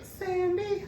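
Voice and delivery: high, sweet little name; girl's voice. Retold or imitated. Party girl telling her name, high and bubbly.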